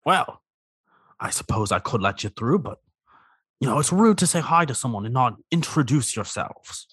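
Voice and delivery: lightly voiced vain crab; a touch of a grand showman crab; crab character voice.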